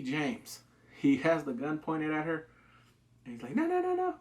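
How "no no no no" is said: high, loud frantic noes; a gunman stopping his partner; urgent no. Retold or imitated. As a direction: stop her fast, panicking. James holds gun on Ellie.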